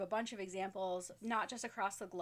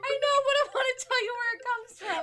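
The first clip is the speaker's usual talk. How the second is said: laughing; 13 dB louder, faster than usual. Real, not acted. Still laughing, trying to explain.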